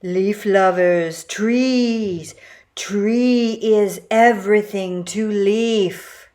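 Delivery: slow, spaced-out leafy voice; slow dreamy leaf creature. A narrator's reading. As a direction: drift through it like a tree hugger.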